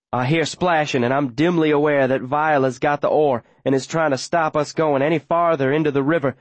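usual speaking voice

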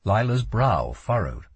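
ordinary delivery

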